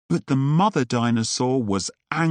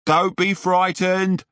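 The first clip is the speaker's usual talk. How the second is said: higher, bold call to reassure; brave shout; 7 semitones higher than usual. Acted. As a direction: shout it out, heroic and confident. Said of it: "Buck shouted".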